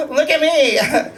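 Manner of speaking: high, drawn-out mock boast; proud showing off. Gays boasting of gold star status, mock proud. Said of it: "gays are, like, proud of that".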